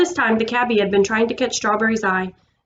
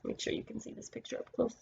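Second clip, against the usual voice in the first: whispered aside; 10 dB quieter than usual. Real, not acted. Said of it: "aside to the child listening".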